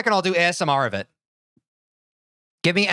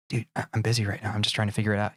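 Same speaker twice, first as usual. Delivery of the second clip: softer, fast hushed reply; hushed brush-off; 10 semitones lower, 5 dB quieter, faster than usual. Pretend. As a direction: wave him off under your breath. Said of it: "your friend whispers to you".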